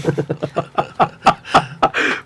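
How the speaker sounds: helpless, breathy chuckle running on; real laughter. Real, not acted. Host reading out listener messages on air, laughing. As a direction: laugh unguardedly at a listener's comment. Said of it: "Perdón que me ría".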